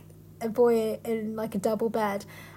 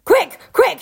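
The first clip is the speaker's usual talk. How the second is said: high, loud call to action; urgent shout; 9 semitones higher, 9 dB louder than usual. A narrator's reading. Reader as Aslan rallying creatures, urgent high shout. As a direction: shout it like a mock alarm. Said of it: "shouted Aslan".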